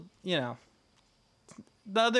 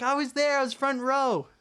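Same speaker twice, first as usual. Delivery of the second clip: high-pitched excited retelling; hyped; 9 semitones higher than usual. Retold or imitated. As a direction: relive being hyped, pitched way up. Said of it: "I was so hyped".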